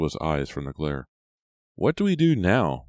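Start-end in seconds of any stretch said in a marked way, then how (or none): 1.8-2.9 higher, louder grumpy question. A narrator's reading. Ricky grumpily asks the leader about the next step, grumpy. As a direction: ask it with a touch of grumpiness. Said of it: "Ricky asked him grumpily".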